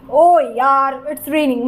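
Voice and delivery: exasperated groan of ओ यार, then a sinking grumble; annoyed. Shown as a demonstration. Teacher demonstrates being cross at rain, annoyed. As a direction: complain about the rain, letting it drop. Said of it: "मतलब आपको गुस्सा आ रहा है".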